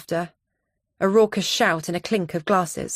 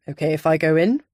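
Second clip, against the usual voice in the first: Donovan voice; 4 dB louder than usual. A narrator's reading.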